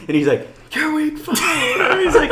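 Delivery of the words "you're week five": very high, incredulous imitation of an instructor; mock scolding. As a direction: act out an appalled drill instructor. Instructor scolding marchers, retold.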